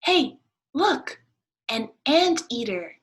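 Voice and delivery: excited duck. Acted. Duck spotting something, high excited cry. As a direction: shout it out in delight.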